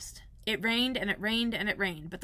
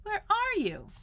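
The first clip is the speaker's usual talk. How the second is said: crying out; 12 semitones higher, slower than usual. Acted. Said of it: "cried Christopher Robin".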